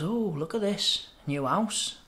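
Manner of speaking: slow, drawn-out nosy parrot remark; lightly voiced parrot sizing the place up; joke parrot. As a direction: swoop up on the "oh", then drop. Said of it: "and the parrot goes".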